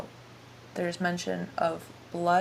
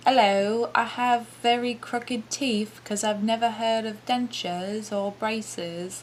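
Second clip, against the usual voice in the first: exaggerated British accent, pitched up; 4 semitones higher than usual. Podcast host shows what might offend, caricature British accent. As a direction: do a mocking, hammed-up British accent. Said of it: "is the British accent offensive?".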